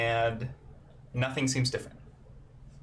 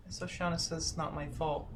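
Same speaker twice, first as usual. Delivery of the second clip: put-on quiet, higher little girl; soft child voice; 3 semitones higher, 6 dB quieter, faster than usual. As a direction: say it like a shy kid. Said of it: "And Chelsea says".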